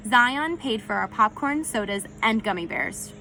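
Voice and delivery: ordinary delivery, nothing marked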